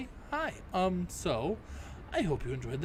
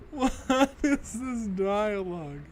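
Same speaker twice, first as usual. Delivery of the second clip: high, slow, louder words through laughter; laughing; 7 semitones higher, 8 dB louder, much slower than usual. Real, not acted. Player mocking the game's dialogue, slow high laughing. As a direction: drag it out while still laughing.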